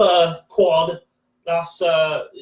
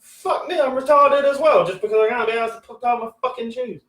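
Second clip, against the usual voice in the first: incredulous outburst; 6 semitones higher than usual. Real, not acted. Outraged reaction to old lady.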